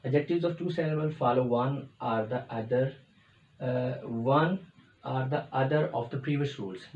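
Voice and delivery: slow, deliberate dictation of an English rule; teacher reading the textbook rule; reading a rule aloud. Acted. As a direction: read it out slowly for students to note down. Reads the rule from the board.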